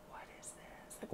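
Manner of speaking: breathless whisper of disbelief; stunned whisper. Retold or imitated. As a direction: hush it, as if you can't believe your eyes. Stunned by a bird thrown at her.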